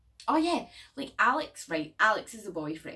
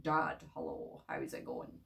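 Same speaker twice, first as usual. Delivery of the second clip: deep middle-aged man; 7 dB quieter than usual. Retold or imitated. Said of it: "came at the door and was like".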